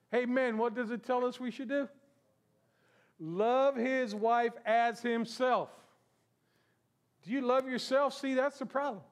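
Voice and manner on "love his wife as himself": slow emphatic reading. Shown as a demonstration. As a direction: spell it out slowly so the men hear it. Repeated, slowed for emphasis.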